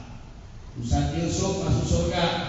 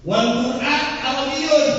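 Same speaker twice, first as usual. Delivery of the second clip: high, loud, slow Arabic quote; a ringing recited phrase; raised recitation; 8 semitones higher, 6 dB louder, slower than usual. Shown as a demonstration. Arabic quoted higher and louder.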